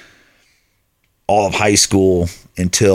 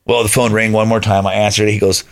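usual speaking voice

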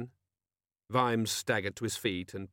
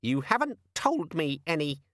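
high, mildly sullen squeak; sulky demon; 6 semitones higher than usual. A narrator's reading. Demon blaming Vimes for no appointments, mildly sulky. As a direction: sulk at the owner in a little voice. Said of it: "said the demon sulkily".

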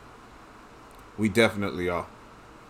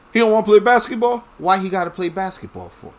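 much higher, louder, scoffing mimicry; mocking quoted voice; 9 semitones higher, 5 dB louder than usual. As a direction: mimic the critics with disbelief. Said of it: "What somebody say?".

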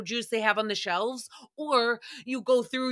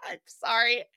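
usual speaking voice

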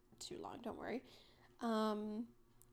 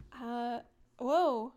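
startled whoa; 9 dB louder than usual. Real, not acted. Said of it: "I just jiggled my".

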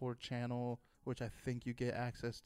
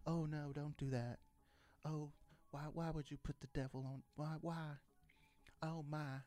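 lightly voiced scandalized believers, pitched a little up; disapproving devout Christians; 3 semitones higher, 5 dB quieter than usual. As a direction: fret softly like appalled churchgoers.

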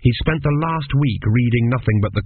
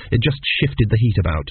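ordinary delivery

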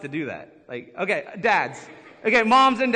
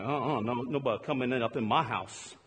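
lower, softer defiant vow; mock defiant; 8 semitones lower, 6 dB quieter than usual. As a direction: protect the house, low and firm. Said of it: "I'm like".

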